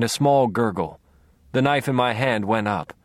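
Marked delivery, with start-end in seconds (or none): none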